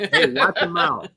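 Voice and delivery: put-on high cranky old man; scolding old codger; old prospector.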